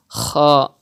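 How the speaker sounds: "kha" with a kh scrape in place of throaty h; rasped kh. Shown as a demonstration. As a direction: scrape "kha" at the back of the mouth. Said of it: "it's also not correct".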